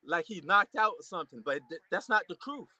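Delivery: ordinary delivery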